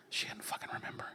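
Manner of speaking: imitated hushed, furtive whisper; secretive whisper. Dad excusing taking the bracelet, secretive whisper. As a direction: whisper it as a sneaky justification.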